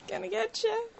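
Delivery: high, soft silly monster; tiny-armed dinosaur threat, playful; mock T-Rex. T-Rex arms joke.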